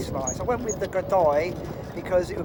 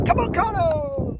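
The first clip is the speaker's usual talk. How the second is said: excited; 6 dB louder, slower than usual. Real, not acted. Cheering own shot.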